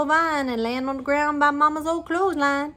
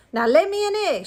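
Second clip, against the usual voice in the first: high, loud bossy shout; tough shout; 9 semitones higher, 5 dB louder than usual. A narrator's reading. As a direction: order the hen roughly. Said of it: "shout the giant real tough".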